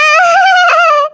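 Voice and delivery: high, drawn-out taunting laugh; long high-pitched cackle of ridicule; mocking laugh. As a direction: laugh in the elder's face. Mocking laugh at mother-in-law.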